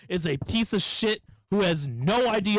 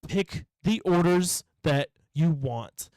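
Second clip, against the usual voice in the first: very slow, word-by-word exasperation; exasperated spelling-out; slower than usual. Retold or imitated. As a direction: say "Pick the orders that you want" slowly, each word set apart. Acted quarrel, spelling out the choice.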